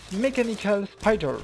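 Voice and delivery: self-mocking English accent on the game term; bad English accent. Real, not acted. Gamer naming a game enemy in clumsy English accent. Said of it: "Mon accent anglais est totalement pourra".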